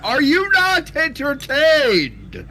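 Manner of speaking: slow, high and loud arena challenge; mock gladiator taunt. Taunting his friend, a slow and loud gladiator roar. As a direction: bellow it to the crowd like a gladiator. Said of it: "quoting the Gladiator line as a taunt".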